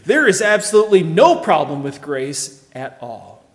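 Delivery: NO hit hard and loud; emphatic. Acted. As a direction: pound each word of the denial. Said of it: "he's gonna be very emphatic about it".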